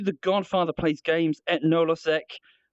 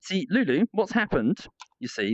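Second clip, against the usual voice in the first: ordinary talk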